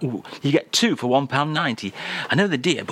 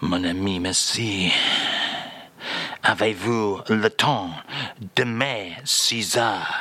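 hammed-up French accent, lightly lower; mock French accent; 5 semitones lower than usual. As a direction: play the French crooner in a comic French accent. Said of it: "And again, if you will".

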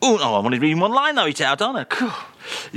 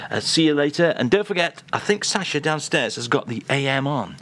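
ordinary talk

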